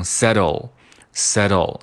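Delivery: "settle" with a quick flapped t; tongue-tip flap. Shown as a demonstration. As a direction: flick the middle t of "settle" lightly. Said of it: "是舌尖的一个跳动". (跳舌音读法)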